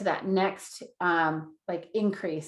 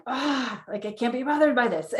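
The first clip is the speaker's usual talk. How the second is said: higher, quick exasperated groan into words; mock exasperation; 4 semitones higher than usual. Parent interrupted by kid's questions, mock exasperated. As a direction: act out being bothered mid-task, voice pitched up.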